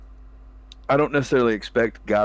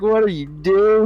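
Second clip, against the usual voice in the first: much higher, louder, slow incredulous cry; mock disbelief; 10 semitones higher, 5 dB louder, slower than usual. Friends reacting to a whiffed combo, high, loud and slow. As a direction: stretch it out in disbelief. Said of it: "We're like".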